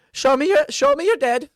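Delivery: a brisk battle healer taking charge; commanding cleric. Acted. Cleric demands to see wounded.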